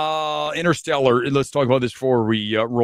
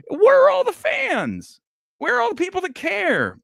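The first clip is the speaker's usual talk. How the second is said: exasperated shout; 4 dB louder than usual. Real, not acted. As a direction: yell it out in disbelief at the missing fans. Ranting at absent fans.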